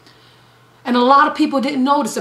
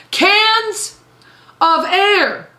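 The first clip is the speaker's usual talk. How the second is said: much higher, punched incredulous; incredulous punchline; 9 semitones higher, 3 dB louder, much slower than usual. Real, not acted. Preacher marveling at China selling canned air, incredulous. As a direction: sell the absurdity, pitch way up.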